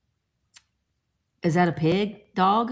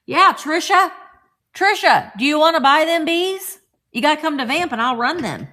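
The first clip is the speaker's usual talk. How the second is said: loud, high, eager call to a viewer; 7 semitones higher, 7 dB louder than usual. Real, not acted. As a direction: call across the room to Trisha, louder. Calling out to chat viewer.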